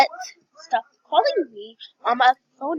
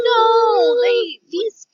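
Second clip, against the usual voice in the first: dismayed cry; 10 semitones higher, 8 dB louder, much slower than usual. Real, not acted. Dismayed at sold-out item.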